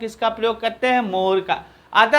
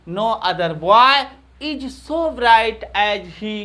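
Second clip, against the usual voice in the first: very slow, every word stretched; slow dictation; much slower than usual. Shown as a demonstration. Teacher to students copying the sentence, slow dictation. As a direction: spell it out slowly so students can write. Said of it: "teacher dictates the full sentence".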